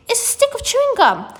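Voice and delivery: put-on very high, thrilled girl's squeal; excited shriek. A narrator's reading. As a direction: keep shrieking, giddy with discovery. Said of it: "she shrieked".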